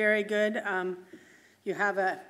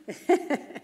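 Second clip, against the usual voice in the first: real laugh; 6 semitones higher, 3 dB louder than usual. Real, not acted. Audience laughs at her joke.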